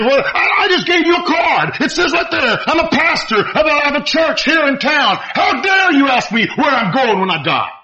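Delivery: high-pitched indignant protest, imitated; angry retort. Offended pastor answering the preacher's question, indignant imitation. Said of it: "never saw a man get so angry".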